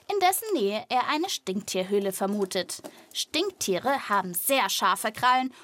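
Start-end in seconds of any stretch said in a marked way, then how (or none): none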